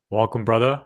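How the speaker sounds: usual speaking voice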